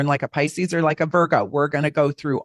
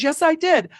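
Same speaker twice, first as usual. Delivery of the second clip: waiter insisting; 11 semitones higher than usual. Retold or imitated. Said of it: "He goes".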